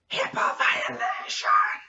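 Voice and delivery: hoarse, drawn-out whisper-scream; strained scream. Acted. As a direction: scream it slowly with no voice behind it. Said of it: "I was there screaming".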